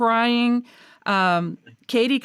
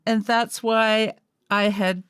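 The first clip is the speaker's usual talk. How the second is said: ordinary talk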